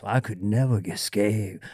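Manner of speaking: lightly voiced low, soft villain line; a hint of the eerie villain; Psycho Bill voice. Podcast host demonstrating the character's voice, low and soft. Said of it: "when you hear that voice".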